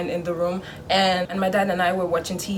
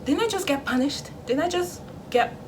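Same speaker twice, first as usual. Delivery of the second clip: high but held-down indignation; angry disbelief; 7 semitones higher than usual. Acted. Punished child answering the parent's friendly questions, indignant. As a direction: protest in a raised pitch, without volume. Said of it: "I'm angry".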